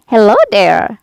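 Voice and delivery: loud friendly call to a passerby. A narrator's reading. Scarecrow calls out to Dorothy on the road, loud. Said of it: "called the Scarecrow".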